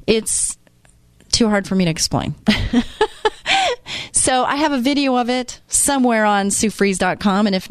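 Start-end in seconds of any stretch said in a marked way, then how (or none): 2.4-4.1 short laugh, much higher than her talk; real laugh. Real, not acted. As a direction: laugh it off, too hard to explain. Laughing off a hard explanation.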